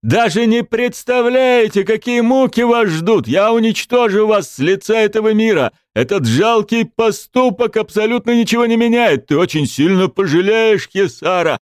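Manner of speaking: high furious raging king; roaring tyrant king. Acted. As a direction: rage and threaten at full force. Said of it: "обезумев, рявкнул король".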